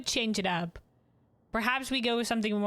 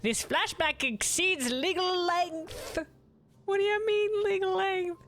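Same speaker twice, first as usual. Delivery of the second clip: high character voice; 7 semitones higher than usual. Acted. Voicing game characters in banter.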